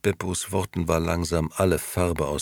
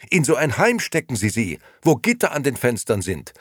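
put-on higher, insistent young man pleading; desperate Gigi, pleading; 10 semitones higher, 4 dB louder than usual. Gigi begging Beppo not to go to the police, higher. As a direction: make him see the danger to Momo.

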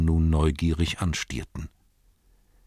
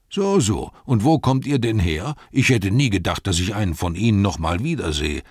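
delighted innkeeper; 3 semitones higher, 4 dB louder than usual. Acted. Innkeeper Butterblüm welcoming returning hobbits, joyful loud voice. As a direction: bubble over with surprise. Innkeeper greeting old friends.

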